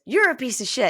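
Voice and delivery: loud accusing mock outrage; acted insult. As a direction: hurl it as the angry girlfriend. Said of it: "And then she says".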